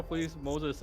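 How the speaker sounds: ordinary delivery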